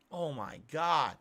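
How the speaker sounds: normal talking voice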